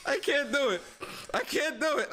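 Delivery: can't hold in the laugh. Real, not acted. Streamer breaking character mid bit, giggling and laughing through the words. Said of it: "I'ma laugh".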